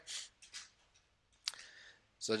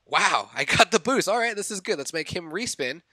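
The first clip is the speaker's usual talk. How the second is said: louder, higher delighted recap; retold excitement; 5 semitones higher, 6 dB louder than usual. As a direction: relive the lucky boost. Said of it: "I'm like".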